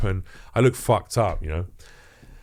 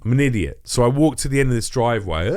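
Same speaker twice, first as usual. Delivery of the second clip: ordinary talk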